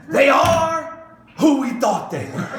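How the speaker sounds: shouted opening then laughing through the rest; a triumphant laughing punchline, loud start; God answering the serpent, shouting then laughing; God quoting the coach. Retold or imitated. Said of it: "and so you can just see God".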